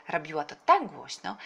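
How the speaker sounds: ordinary delivery, nothing marked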